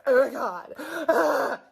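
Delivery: loud, laughing mock emoting; a put-on, laughing "oh my God". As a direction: ham up an emotional outburst. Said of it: "and emoting".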